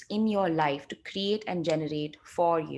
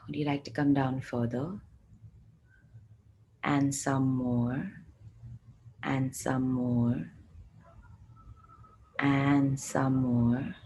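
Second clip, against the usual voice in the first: guided meditation; 5 semitones lower, 3 dB quieter than usual. Acted. Coaxes the barriers further.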